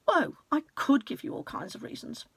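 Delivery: plain reading voice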